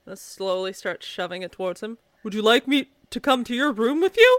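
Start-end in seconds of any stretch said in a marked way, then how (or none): 2.2-4.4 Clive voice. Acted. Clive asks child, playing cat.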